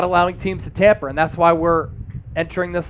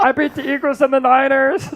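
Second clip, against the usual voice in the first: high, strained quarterback caricature; throat-straining athlete impression; Patrick Mahomes; 6 semitones higher than usual. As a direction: push the put-on voice up until it nearly cracks into laughter. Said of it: "that kinda hurts my throat".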